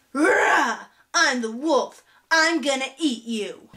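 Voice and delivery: put-on a little boy's lightly growled wolf act; mock scary wolf. Boy interrupting the story as the wolf, mock scary. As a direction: play at being the wolf, drawing out the threat. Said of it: "my creepy parts of talking about the wolf".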